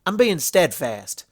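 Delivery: a stubborn country mare refusing help; Applejack voice. Acted. Applejack turning down Luna's offer, folksy and firm. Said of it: "said with a nod".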